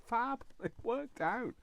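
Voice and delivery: very high, drawn-out giggly squeal; high-pitched happy marvelling; delighted. Real, not acted. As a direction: squeal slowly with tearful relief. Delighted the pieces worked, laughing.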